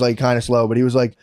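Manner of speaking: ordinary talk, nothing marked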